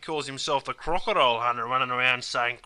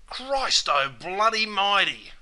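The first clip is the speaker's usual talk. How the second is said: slow, stretched-out exclamation of amazement; surprised or angry exclamation; 3 semitones higher, slower than usual. Acted. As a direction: cry it out, stretching the words. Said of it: "when they're surprised or angry".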